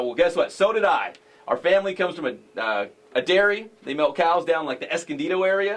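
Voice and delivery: put-on lightly done Southern accent for the coach; a hint of a Southern accent, bragging about the family dairy. Host's light Southern accent as the coach. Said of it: "you always have this Southern accent".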